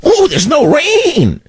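hammed-up high-pitched panicked wail; mock screaming. Host mocking Californians panicking over drought, mock screaming. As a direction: wail it in exaggerated, mocking alarm. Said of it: "they were screaming".

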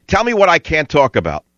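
usual speaking voice

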